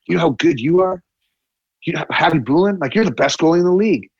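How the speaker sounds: fired-up pep talk. Retold or imitated. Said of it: "he pumped everybody's tires".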